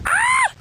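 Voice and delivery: sudden high yelp of fright; startled shriek. Real, not acted. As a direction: cry out in surprise.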